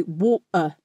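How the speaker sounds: "water" with the T swapped for a glottal stop; Cockney "wa'er" for "water". Shown as a demonstration. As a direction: cut "water" in two with a catch in the throat. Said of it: "is Cockney".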